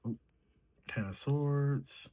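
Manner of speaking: usual speaking voice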